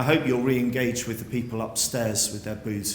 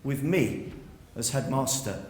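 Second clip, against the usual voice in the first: usual speaking voice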